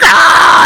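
loud, high mock howl of laughter; mock cackle. Imitating bandmates laughing at him, loud high cackle. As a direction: burst out like a jeering crowd.

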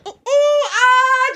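hooting "ooh ooh" rising to a loud high shriek; wild mimicked whoops; weird animal noises. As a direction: imitate a man screeching like an animal. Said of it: "weird animal noise and shit".

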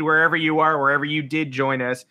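ordinary talk

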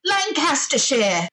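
put-on high, drawn-out British accent suggestion; imitated British audience. British players throwing out a game suggestion, mock British accent. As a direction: call out the place name slowly in imitated British. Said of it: "they're like".

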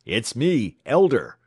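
high, loud desperate cry; screaming. A narrator's reading. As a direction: yell out who you are. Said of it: "screamed the clerk".

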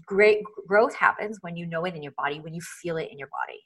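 ordinary delivery

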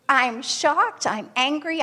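normal talking voice

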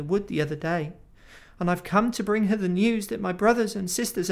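high, fast, frightened gabble; trembling, hardly able to speak. Acted. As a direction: stammer it out in fear. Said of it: "trembling so that he could hardly speak".

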